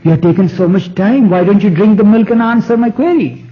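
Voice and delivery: a king whose patience has run out; exasperated ruler pressing for an answer; exasperated emperor. Retold or imitated. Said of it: "He got exasperated and says".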